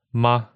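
MA very light, just let out; short unaccented "ma"; neutral tone. Shown as a demonstration. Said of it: "It's very light. You just let it out".

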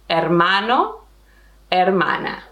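"Hermano, hermana" both starting on the vowel; "Hermano, hermana" said as "ermano, ermana"; silent H. Shown as a demonstration. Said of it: "pretend that the H is not there".

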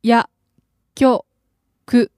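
薬 and 局 said apart with a pause; over enunciating 薬局 in two parts; slow syllable by syllable. Shown as a demonstration. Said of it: "Slowly, please".

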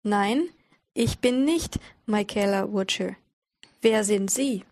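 slow and carefully paced; slow reading. Shown as a demonstration. Teacher reading the dialogue slowly for learners. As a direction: read it slowly, word by word, for learners. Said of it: "the dialogue will be read slowly".

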